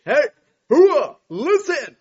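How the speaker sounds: high, slow barked army holler; loud gung-ho soldier. Retold or imitated. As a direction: bellow like a drill sergeant. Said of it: "when Al Shepard needs to get everybody's attention".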